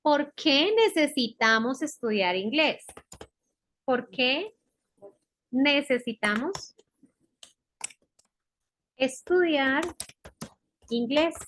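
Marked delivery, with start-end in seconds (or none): 3.8-11.3 word-by-word dictation. Shown as a demonstration. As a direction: dictate in small pieces, long pauses between them. Teacher dictates it piece by piece. (dictado pausado, palabra por palabra)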